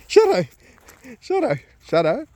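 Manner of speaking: high, sing-song calls; calling the dog. Real, not acted. Owner calling Shadow in a high coaxing voice. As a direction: coax the dog over with his name.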